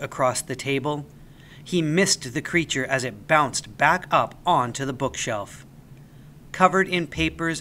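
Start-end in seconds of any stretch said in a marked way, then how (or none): none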